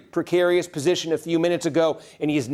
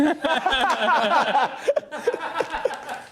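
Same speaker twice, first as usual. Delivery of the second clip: surprised laugh; 9 semitones higher than usual. Real, not acted. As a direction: burst of high laughter. Flustered by surprise special report.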